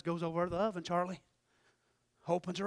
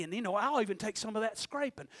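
ordinary delivery

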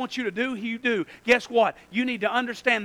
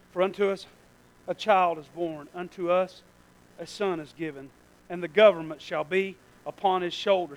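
lower, gentle reading of the verse; soft scripture reading; 5 semitones lower, 4 dB quieter than usual. Real, not acted. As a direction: read the verse tenderly. Reads scripture softly.

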